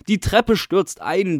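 loud, slow, high desperate yell; desperate roar. A narrator's reading. Caretaker bellowing a warning up the stairwell, desperate yell. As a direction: bellow it out, dragging each word. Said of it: "brüllt er".

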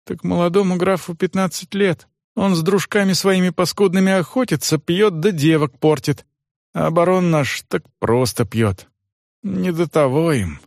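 servant Johan. Acted.

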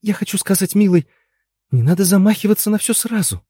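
high, placating wife's voice; catching herself. A narrator's reading. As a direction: soothe a husband after an outburst. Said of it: "но вовремя спохватилась".

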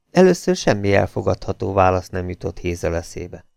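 ordinary delivery, nothing marked

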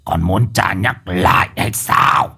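low, slow, cold threat; a sorcerer snapping at disobedient ghosts; cold menacing. Acted. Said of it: "Lão lạnh giọng".

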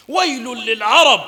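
high, loud, ringing hadith recital; preaching at full force. Acted. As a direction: proclaim it like a sermon peak. Slow loud warning.

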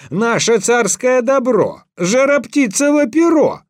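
high, loud, commanding outburst; angry shout. A narrator's reading. Tsar demands an answer from Ivan about the hidden feather, angry shout. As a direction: shout it down as an outraged ruler. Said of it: "закричал ему со гневом".

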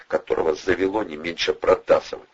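plain reading voice

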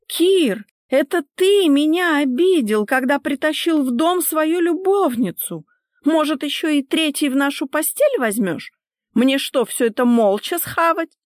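high, loud, aggrieved outburst; outraged tirade. Acted. Wife accuses husband over his mistress, high outraged tirade. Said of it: "возмутилась та".

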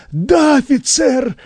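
high, strained, drawn-out reply; anxious high-pitched little man; nervous. A narrator's reading. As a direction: squeeze it out high and slow. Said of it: "выдавил Пучеглазый, начиная нервничать".

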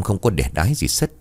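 plain reading voice

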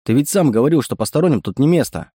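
plain reading voice

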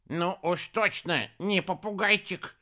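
high, slow, displeased retort; disgruntled. A narrator's reading. Хиша answers who he is, disgruntled. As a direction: draw it out high, somewhat put out. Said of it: "недовольно ответил Хиша".

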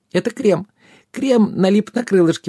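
high-pitched fairy exclamation; exclaiming fairy. A narrator's reading. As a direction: cry out in a high, excited little voice. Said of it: "воскликнула она".

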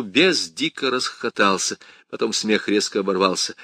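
ordinary delivery, nothing marked

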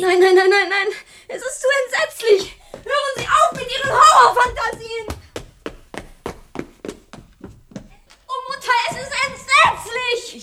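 high, loud and panicked, half laughing in shock; horrified outburst. Acted. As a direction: cry out in disbelief and horror. Daughter horrified by metamorphosis claim.

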